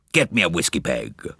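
put-on old man's quavering order; hoarse old gentleman. A narrator's reading. Old gentleman ordering from the steward, hoarse voice. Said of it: "A hoarse, quavering voice".

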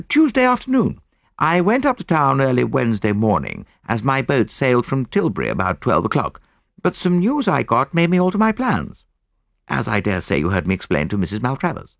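put-on high-pitched young officer; young soldier. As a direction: answer readily in a lifted, youthful voice. Young soldier gives his account.

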